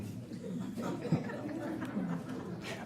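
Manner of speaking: silent wheezing laugh; breathless laugh. Real, not acted. Preacher laughing at his cows joke, breathless.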